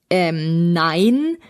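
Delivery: hesitant, drawn-out denial, rising at the end; sheepish, not very convincing no; unconvincing. A narrator's reading. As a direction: deny it as if caught out. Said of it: "meinte Benjamin mäßig überzeugend".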